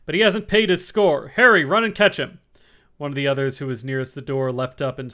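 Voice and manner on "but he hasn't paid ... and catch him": louder, gruff sailor outburst; loud cry. A narrator's reading. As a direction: bellow it across the room. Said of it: "cried Silver".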